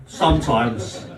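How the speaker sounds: slow, low, hesitant answer; nervously unsure. Real, not acted. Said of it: "The most nervous sometimes you've heard".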